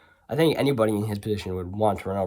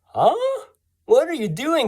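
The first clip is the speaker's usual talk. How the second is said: puzzled huh; 7 dB louder than usual. Retold or imitated. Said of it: "I looked at him like".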